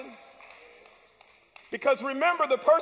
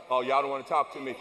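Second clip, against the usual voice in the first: much lower, offhand aside; muttered aside; 11 semitones lower, much faster than usual. Real, not acted. Preacher teasing a silent congregation with a low aside. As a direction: grumble it under your breath.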